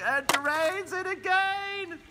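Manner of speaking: ecstatic call. Pretend. Announcer ecstatic at another hole in one. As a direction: soar up on the call, slow.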